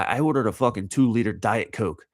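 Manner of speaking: ordinary talk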